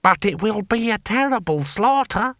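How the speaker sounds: high, slow voice of dismay; horrified. A narrator's reading. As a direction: protest in drawn-out, high-pitched alarm. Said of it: "looked on in horror".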